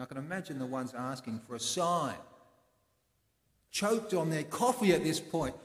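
ordinary delivery

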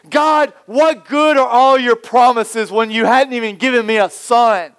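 Abraham yelling his grievance at God; complaining Abraham. Pretend. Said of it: "he began to complain".

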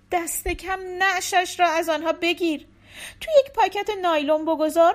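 urgent, desperate orders; hint of a distraught mother; frantic wife. A narrator's reading.

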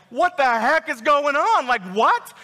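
high, drawn-out incredulous outburst; emphatic, emotional. Acted. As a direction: act out total disbelief, stressing each word. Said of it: "emphasizing and emotional".

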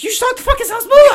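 high, loud, fast disbelieving yell; excited yell. Retold or imitated. Friend shouting at him about the celebrity, high laughing yell. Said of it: "He was like".

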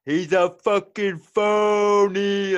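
loud, high, drawn-out accusing yell; heckler calling out. Retold or imitated. Dude in the background exposing a fake accent, loud drawn-out heckle. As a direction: imitate a bystander exposing a fraud, stretching it out. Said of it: "some dude in the background".

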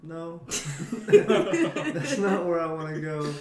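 loud, slow, laughing imitation; laughing mock rejection. Imitating an eighth-grade girlfriend's breakup line, laughing loud. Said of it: "person's like".

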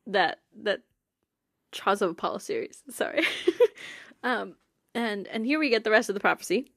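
usual speaking voice

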